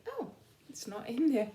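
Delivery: normal talking voice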